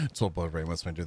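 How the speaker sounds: usual speaking voice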